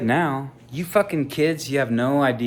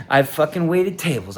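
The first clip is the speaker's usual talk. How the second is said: ordinary delivery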